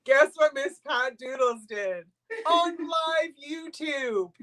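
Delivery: slow, gleeful shout of the news to the viewers; milk the big reveal, drawing it out; teasing announcement. Acted. Host tattling on Miss Pat to the live YouTube viewers, slow gleeful shout.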